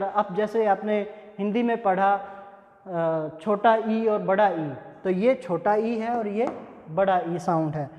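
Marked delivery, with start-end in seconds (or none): none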